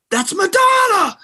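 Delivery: shouting in excitement at hearing the radio; freaking out. Retold or imitated. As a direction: yell it like you can't believe it. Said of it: "I was freaking out".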